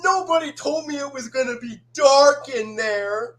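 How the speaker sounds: loud, high, alarmed cry; frightened cartoon character impression. Character refusing to enter the dark, loud alarmed cry. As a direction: balk loudly in a high character voice. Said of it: "now it's my turn".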